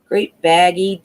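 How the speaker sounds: usual speaking voice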